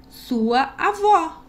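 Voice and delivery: drawn-out, emphatic "Sua avó."; exclamatory reading. Shown as a demonstration. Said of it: "Ponto de exclamação".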